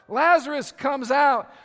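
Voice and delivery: ordinary delivery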